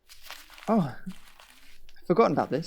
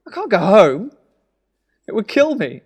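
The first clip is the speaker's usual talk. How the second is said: louder, higher, upset and desperate; distressed outburst; 5 semitones higher, 9 dB louder than usual. Acted. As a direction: cry out that you can't go home. Brother distraught, refusing to go home.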